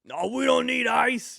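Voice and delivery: high, loud, slow mock dad refusal; a hammed-up proud dad brushing it off; imitating tough dad. Son imitates his dad turning down the ice, mock tough.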